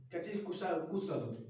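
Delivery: ordinary delivery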